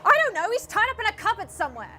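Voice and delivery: fast, high, loud blurting; exasperated shout. Acted. Impostor admits tying up Dingo Dan, rapid exasperated shout. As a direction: yell it out in a rush.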